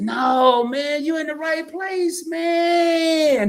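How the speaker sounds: high, drawn-out holler; emphatic reassurance. Real, not acted. Host hollers to a viewer in the right place, emphatic reassurance. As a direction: cry it out high, stretching the last words.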